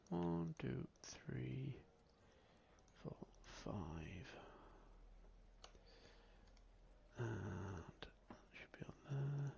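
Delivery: whispered counting to himself; under his breath. Real, not acted. The GM counts action cards to himself in a whisper. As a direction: murmur the count, almost no voice.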